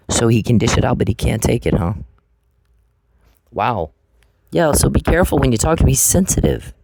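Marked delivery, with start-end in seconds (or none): none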